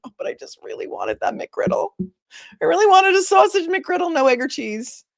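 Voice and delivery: high, loud pleading about the McGriddle; whiny customer. Retold or imitated. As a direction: beg like a disappointed fast-food customer. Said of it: "And you're like".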